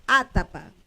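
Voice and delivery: atapa with the force on the opening "a"; stress on first syllable. Shown as a demonstration. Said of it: "yo acentúo la primera".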